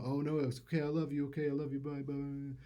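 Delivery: lightly voiced boyfriend cooing; sweet phone goodbye. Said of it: "he would say, you know".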